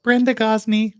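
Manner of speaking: high, loud mock female witness; put-on woman's voice. Acting a woman witness in court.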